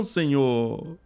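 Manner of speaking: normal talking voice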